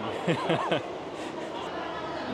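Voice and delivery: unvoiced-sounding, breathy chuckle at oneself; real laugh. Real, not acted. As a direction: laugh off your own awkward attempt. Laughs at own bad-accent attempt. (petit rire gêné)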